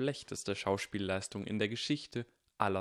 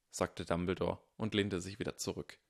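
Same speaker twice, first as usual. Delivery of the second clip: usual speaking voice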